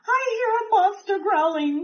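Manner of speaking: scared cry. A narrator's reading. Dragon calling up to the squirrel in fear, high cry. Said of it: "cried Dragon".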